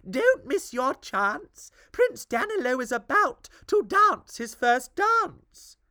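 lightly voiced eager queen. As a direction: urge him on as the queen. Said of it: "the queen said".

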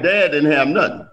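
normal talking voice

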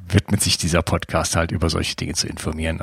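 ordinary talk, nothing marked

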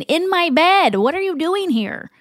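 high, exasperated scoff; a touch of annoyed big sister. Acted. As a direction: push the little sister away with a scoff. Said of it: "D J scoffs".

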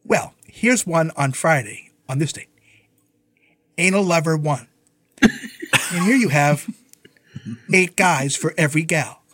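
slow, deliberate mock clerk read-out; loud Lana reading. Imitating Lana reading the bill off to a caller, slow and matter-of-fact. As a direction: imitate an older woman reading out titles, unhurried. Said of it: "and she was loud".

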